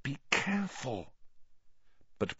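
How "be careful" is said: soft, higher hissed caution; hissed warning. A narrator's reading. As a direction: keep it under your breath, urgently. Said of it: "hissed Hermione".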